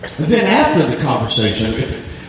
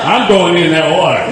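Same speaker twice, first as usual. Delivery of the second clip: laughing declaration; 3 semitones higher, 5 dB louder than usual. Retold or imitated. Jumping in, laughing.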